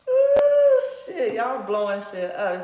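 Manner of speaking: loud, high whoop of excitement; big loud hype reaction; excited exclamation. Real, not acted. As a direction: shout it out, thrilled at the card. Reacting to a dramatic card.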